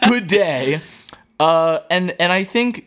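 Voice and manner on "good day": slow, lofty farewell; drawn-out mock-aloof goodbye; hammed-up indifferent Lenny; haughty dismissal. Imitating Lenny's indifferent speech.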